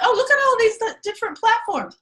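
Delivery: high, delighted discovery; mock excitement. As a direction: act giddy over the platforms. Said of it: "Issa's like".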